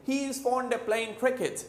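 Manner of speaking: British-style He is fond of as 'heez fonda', playin' without g; British connected speech. Shown as a demonstration. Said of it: "मगर वो क्या बोलेंगे". (British अंदाज़ में 'हीज़ फ़ॉन्डा प्लेइन क्रिकेट')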